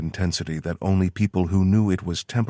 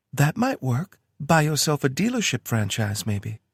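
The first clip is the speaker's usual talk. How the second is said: lightly higher woman's voice; Tara voice; 9 semitones higher, 3 dB louder than usual. A narrator's reading. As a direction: read her lines a bit higher. Tara's voice in story.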